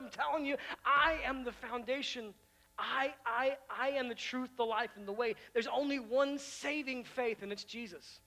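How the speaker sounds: loud, high proclamation; fervent pulpit declaration; preaching voice. Acted. Preaching at full force.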